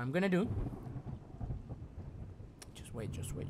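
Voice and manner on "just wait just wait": softer, lower hushed pleading. Real, not acted. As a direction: keep it down while hiding. Hiding from the monster.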